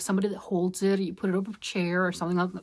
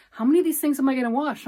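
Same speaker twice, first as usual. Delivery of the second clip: husband's complaint; 6 semitones higher, 5 dB louder, much faster than usual. Retold or imitated. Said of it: "He's like".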